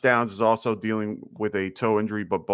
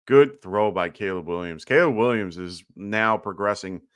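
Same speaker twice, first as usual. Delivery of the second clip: ordinary talk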